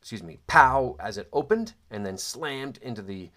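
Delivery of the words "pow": loud, high mock pow of a door kicked in; door bang. Recalling the door slammed open, a loud mock pow. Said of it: "I remember hearing it go pow".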